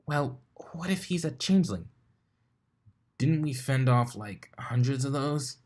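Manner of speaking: high, rushed unicorn voice firing questions; a bookish mare, rattled and demanding answers; flustered Twilight. Acted. Twilight voiced, worried theory.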